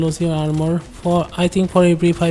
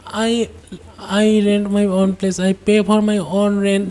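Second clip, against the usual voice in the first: ordinary talk, nothing marked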